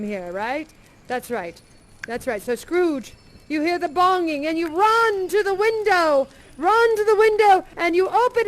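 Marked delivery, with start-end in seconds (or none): none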